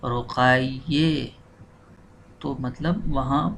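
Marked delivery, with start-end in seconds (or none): 0.0-1.3 रुकैये slow with final e; slow Persian ending. Shown as a demonstration. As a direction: draw out रुकैये, end in e. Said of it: "बल्कि फ़ातिमे". (रुकैये धीरे, आखिर में ए)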